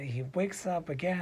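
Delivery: ordinary delivery, nothing marked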